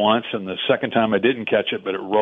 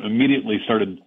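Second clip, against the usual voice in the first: usual speaking voice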